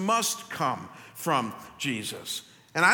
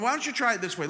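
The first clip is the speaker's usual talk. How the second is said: normal talking voice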